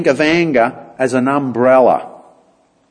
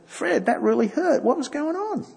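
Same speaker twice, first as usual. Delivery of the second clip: softer, higher, openly caring; gentle caring question; 10 semitones higher, 8 dB quieter than usual. Shown as a demonstration. Showing the men how to open up to a friend, gently. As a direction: ask Fred gently, with real concern.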